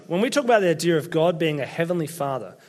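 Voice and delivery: usual speaking voice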